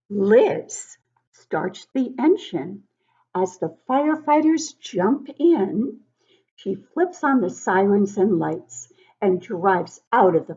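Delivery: lively storybook read-aloud; reading to children. Acted. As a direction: read it like story time for little kids. Teacher reads picture book aloud to children.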